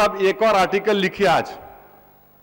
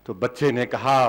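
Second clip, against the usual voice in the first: ordinary delivery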